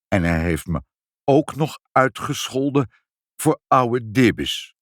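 lightly voiced aggrieved old man; Opa Duizendschoon. A narrator's reading. Old man tells the family of the insult, mildly aggrieved. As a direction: add the insult haltingly, a touch aggrieved. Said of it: "zei opa Duizendschoon".

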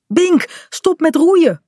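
high-pitched cry of alarm; urgent call. A narrator's reading. Kaat has seen her dog is overboard, calling urgently. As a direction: call out sharply to halt the rower. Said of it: "roept ze".

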